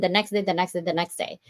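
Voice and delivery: ordinary talk, nothing marked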